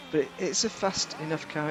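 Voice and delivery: ordinary talk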